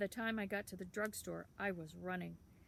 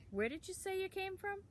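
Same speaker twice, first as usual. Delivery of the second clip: put-on much higher, prying boy's voice; curious town boy; 12 semitones higher than usual. A narrator's reading. Town boy quizzing Sam, much higher prying voice. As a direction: quiz the odd stranger.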